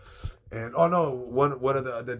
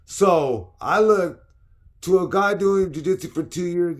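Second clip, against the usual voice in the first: high, loud, slow emphatic rant; heated rant; 10 semitones higher, 12 dB louder, slower than usual. Real, not acted. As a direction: hammer home the injustice. Heated retelling.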